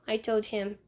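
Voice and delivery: plain reading voice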